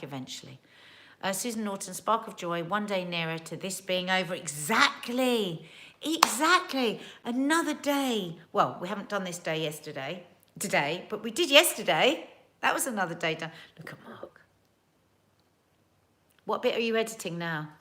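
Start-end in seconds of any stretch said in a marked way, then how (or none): 4.3-7.0 slow, high, loud exactly; emphatic agreement. Real, not acted. 13.8-14.4 unvoiced aside; whisper. Real, not acted.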